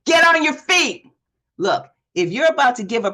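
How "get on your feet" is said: loud, high call to the room; rally them to their feet; punchy coach command; emphatic call. Shown as a demonstration. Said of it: "coach shouts key phrase".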